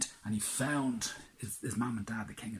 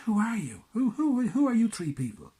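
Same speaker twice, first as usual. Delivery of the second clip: high, loud, urgent demand; alarmed shout; 13 semitones higher, 13 dB louder than usual. Acted. Prince demanding who hides inside, high loud shout. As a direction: shout it out, startled and scared. Prince startled by hidden voices.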